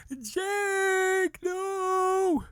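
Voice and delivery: long high wail of hammed-up grief; exaggerated anguished howl; mock crying. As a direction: stretch each word into a fake sob. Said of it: "I'm crying".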